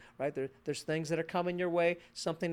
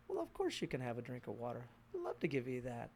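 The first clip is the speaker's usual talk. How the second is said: gentle approval; 6 semitones lower, 8 dB quieter than usual. Retold or imitated. Parent kindly granting polite request.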